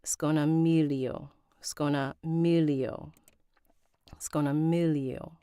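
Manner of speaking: "Sconamiglio" said carefully, G dropped before the L; silent G. Shown as a demonstration. Narrator repeating after a pronunciation clip, "Sconamiglio" with silent G. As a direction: practise "Sconamiglio" as skoh-nuh-MEE-lee-oh. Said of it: "The G is silent".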